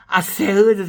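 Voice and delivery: loud mock challenge; loud demand. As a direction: call him out loudly. Retold demand about Magic Man.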